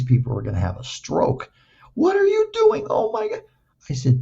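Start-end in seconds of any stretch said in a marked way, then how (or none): 1.9-3.4 very high-pitched alarmed imitation; mock panic. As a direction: mimic horrified onlookers in a squeak. Therapists panicking at his batting stance.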